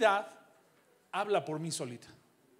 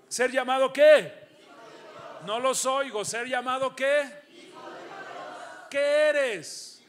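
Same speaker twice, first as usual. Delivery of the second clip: loud, higher call for a response; calling out to the crowd; 4 semitones higher, 6 dB louder than usual. Real, not acted. Pastor demanding the congregation answer him, loud call. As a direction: rouse the room to shout back.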